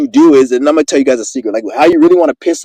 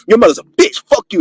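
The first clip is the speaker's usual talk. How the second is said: high-pitched aggressive insult; aggressive cussing; 6 semitones higher than usual. Acted. Showing the blunt way to confront someone, aggressively. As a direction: come at them cussing, pitch jumping up. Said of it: "if you came up to them and be like".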